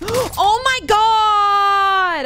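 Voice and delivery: long, loud, drawn-out shout; gasp, then scream it slowly; startled scream. Real, not acted. Gamer caught off guard, loud drawn-out scream.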